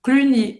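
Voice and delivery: Cluny, rounded French u, y as i; French pronunciation. Shown as a demonstration. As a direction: model Cluny, klu-NI. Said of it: "o Y tem som de I em francês".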